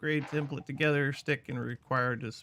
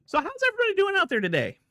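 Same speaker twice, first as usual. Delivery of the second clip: very high, fast, hammed-up host voice; silly greeting voice; 8 dB louder, much faster than usual. Streamer greets chat in a high, fast, silly host voice. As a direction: chirp it like a goofy TV host.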